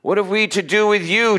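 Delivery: mild cry of a demon, read out; loud cry. A narrator's reading. Demon in the synagogue to Jesus, crying out. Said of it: "cried out with a loud voice".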